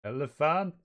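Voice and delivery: lightly acted attack call; Luffy. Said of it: "And Luffy going".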